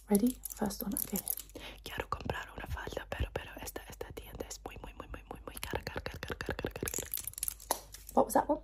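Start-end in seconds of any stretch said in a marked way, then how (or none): 1.8-6.9 soft, unvoiced ear-to-ear whisper; whispered Spanish. Shown as a demonstration. Doctor testing patient's hearing, whispered Spanish. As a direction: whisper it, lingering on the repeated words. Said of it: "I'm just gonna whisper some languages".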